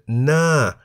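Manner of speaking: "na" drawn out, pitched high then falling. Shown as a demonstration. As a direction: start "na" high and let it drop. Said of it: "pronounced na in the falling tone".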